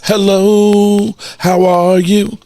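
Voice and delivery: high, loud, sing-song hello; a bright put-on greeting. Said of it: "tell him I said".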